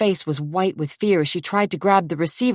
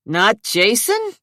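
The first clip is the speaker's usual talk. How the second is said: surprised repeat; 6 semitones higher, 4 dB louder, slower than usual. Acted. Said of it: "Rudy repeated".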